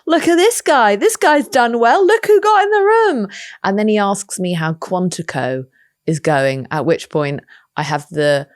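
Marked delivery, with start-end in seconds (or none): 0.1-3.3 high, loud, delighted gloating; giddy inner thought. Retold or imitated. She thinks proudly at the star-studded brunch, giddy. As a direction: brag to yourself, thrilled.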